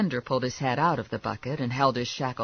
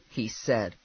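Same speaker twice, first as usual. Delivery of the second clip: ordinary delivery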